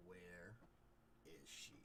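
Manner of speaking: low, soft threatening question; quiet menace. Acted. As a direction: drop low and quiet, word by word.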